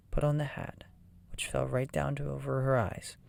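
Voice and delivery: ordinary delivery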